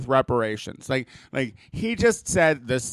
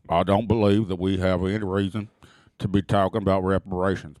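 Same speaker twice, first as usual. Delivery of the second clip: dragging each phrase out like a Kentucky senator; Mitch McConnell impression; 8 semitones lower than usual. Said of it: "You do the accent".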